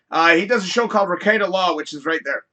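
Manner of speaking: ordinary talk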